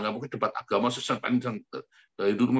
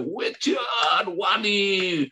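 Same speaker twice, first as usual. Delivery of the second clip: a hint of crowd cheering, higher and far-off; 6 semitones higher, much slower than usual. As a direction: chant it like cheering supporters. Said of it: "Cuman sorak-sorai saja".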